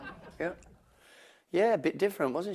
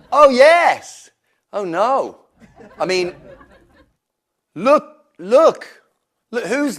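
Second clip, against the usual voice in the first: excited; 8 semitones higher, 4 dB louder than usual. Real, not acted. Excited at the arriving guest.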